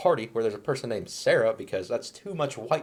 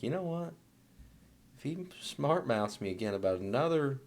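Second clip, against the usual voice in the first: mock menace, much softer; quiet plotting threat; 2 semitones higher, 9 dB quieter than usual. Wives fed up with smart-mouthed husbands, quiet mock threat. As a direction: plot it under your voice, half-joking.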